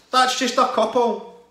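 worked up. Real, not acted. Winding down the rant, still worked up. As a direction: still fired up, drawn out.

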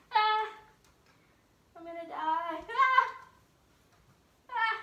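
loud high frightened yelps; scared shrieks. Real, not acted. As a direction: shriek in fear of falling.

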